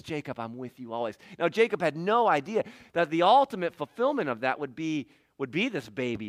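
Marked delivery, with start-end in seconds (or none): 0.0-1.2 soft, lowered, close-by voice; quiet nearby reassurance. Acted. Jesus standing by Jacob at the ladder, quiet reassurance. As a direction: say it gently to someone right beside you. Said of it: "stands there and says".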